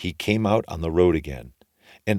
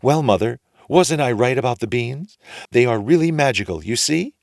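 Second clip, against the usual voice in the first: put-on higher, louder proud boy; proud Jack; 6 semitones higher, 5 dB louder than usual. A narrator's reading. Jack showing his mother the gold, proud and louder. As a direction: boast to mother. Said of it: "and said".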